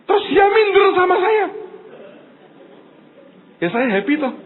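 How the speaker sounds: usual speaking voice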